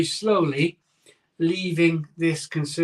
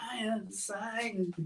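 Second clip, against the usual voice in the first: softly laughing through it; 5 semitones higher, 11 dB quieter than usual. Real, not acted. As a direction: say it while laughing quietly. Repeats the phrase laughing.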